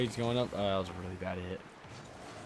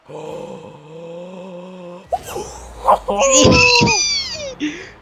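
anguished wail; 16 dB louder than usual. Real, not acted. Loud cry after the play.

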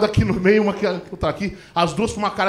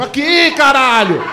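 taunting yell; 4 semitones higher, 4 dB louder, much slower than usual. Retold or imitated. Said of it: "balança o saco imaginário".

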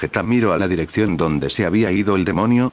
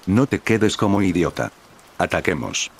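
usual speaking voice

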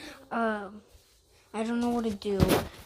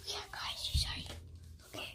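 whispering; 13 dB quieter than usual. Real, not acted. Hiding from sister.

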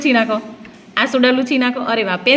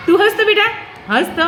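laughing coax; 8 semitones higher, faster than usual. Real, not acted. Teacher coaxing crying child.

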